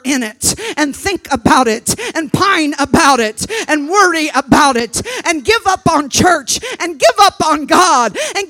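loud, high, hammering exhortation; fervent revival preacher at full cry; full-force preaching. Acted. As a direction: build to a shouted list of commands.

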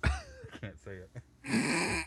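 breaking up with laughter; laughing. Real, not acted. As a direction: squeak through the laughter. Laughing too hard.

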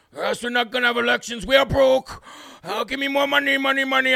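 a hammed-up pleading little man asking for money; mocking whiny Zelensky. Host mocking Zelensky begging for money in a whiny voice. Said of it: "his little D voice like this, trying to sound like Puss in Boots".

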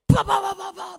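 loud shouted bah bah knocking noise; mock aggressive knocking, shouted high; imitated pounding knock. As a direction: bang it out like a fist on the door. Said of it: "this big, bad knock on my door".

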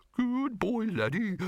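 high, faraway dog voice; ghostly dog. Acted. Laddie calls from the darkness, high. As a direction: echo from the dark.

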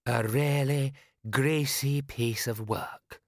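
an aside meant to carry to the rafters; aristocratic stage whisper. A narrator's reading. Lord Rust to Mister Boggis, carrying aristocratic whisper. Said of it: "that special aristocratic whisper that carries".

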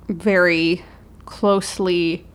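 ordinary talk

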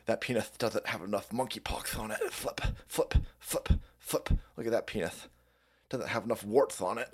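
quiet dim mumble; mumbled mockery. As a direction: mutter like a zombie. Mock scroller commentary.

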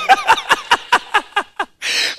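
squealing falling laugh; helpless high laughter; high shrieking laugh. Real, not acted. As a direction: let a shrill laugh fall away. Uncontrollable laughter.

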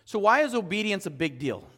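usual speaking voice

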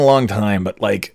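ordinary talk, nothing marked

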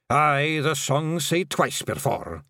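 a slow, solemn bard's answer; William the Gonigal. A narrator's reading.

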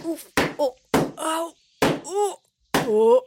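string of separate high "oof" and "ow" yelps; grunts of pain. A narrator's reading. Monkey soldiers whacking themselves in the face, pained grunts. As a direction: yelp as if bopped one after another. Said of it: "grunts of pain echoed around the clearing".

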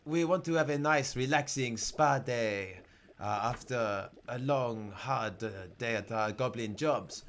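put-on French-accented goblin explaining a wish; measured comic French accent. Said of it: "The second goblin speaks up".

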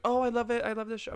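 high-pitched imitation of gushing fans; mock gushing. Strangers see the back of the shirt, mock gushing.

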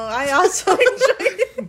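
breaking into laughter mid-line; laughing. Real, not acted. Laughs at own slip.

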